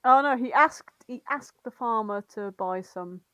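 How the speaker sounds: normal talking voice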